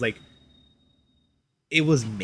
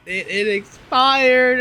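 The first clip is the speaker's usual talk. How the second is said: awkward admission; 10 semitones higher, 7 dB louder, much slower than usual. Retold or imitated. Awkward message to seller.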